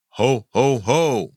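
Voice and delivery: measured Santa belly-laugh call; spaced-out jolly Santa catchphrase; Santa Claus ho-ho-ho. Shown as a demonstration. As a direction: give a Santa's ho, ho, ho. Repeats Santa's catchphrase as joke answer.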